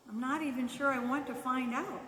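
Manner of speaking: usual speaking voice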